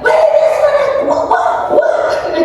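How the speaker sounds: high, loud mock scolding from granny; granny yelling. Granny scolding the grandsons who threw him, high mock yell. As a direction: holler at the boys like an old granny. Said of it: "granny started to yell".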